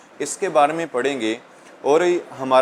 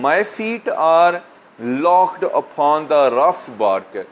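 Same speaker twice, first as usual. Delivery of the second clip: slow, spaced-out verse reading; poem recitation; much slower than usual. Real, not acted. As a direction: recite it slowly, pausing mid-line. Reads poem line aloud to students.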